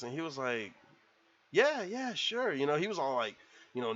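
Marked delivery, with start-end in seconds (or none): 1.5-2.5 higher, mock-nervous agreement; nervous acceptance. Rick invited over by the wife, lightly nervous. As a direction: agree high-pitched with a hint of nerves. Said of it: "nervous 'cause".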